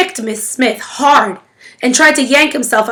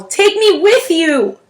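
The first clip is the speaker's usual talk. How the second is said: slow, high wailing cry; a child begging to be taken along; howling plea; 7 semitones higher, slower than usual. A narrator's reading. Boy howls to the departing family, slow and high. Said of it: "Jamie began to howl".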